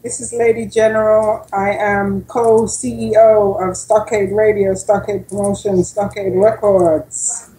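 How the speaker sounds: usual speaking voice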